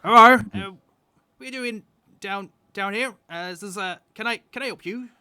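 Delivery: high-pitched halfling countrywoman; halfling farm woman. Acted. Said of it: "a halfling woman".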